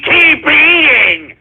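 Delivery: slow taunt. Acted.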